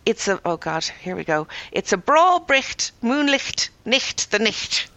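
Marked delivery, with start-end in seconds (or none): 1.7-4.9 "bricht, moonlicht, nicht" with a guttural ch before the t; Scottish ch sound. Shown as a demonstration. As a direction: draw out "bra bricht", rasping the ch at the back of the throat. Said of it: "have trouble with that old favourite".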